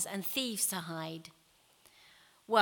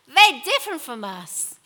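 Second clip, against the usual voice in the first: loud, raised protest; shouted objection; 4 semitones higher, 11 dB louder than usual. A narrator's reading. Someone in the crowd objects to Samaritans, shouted. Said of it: "shouted someone".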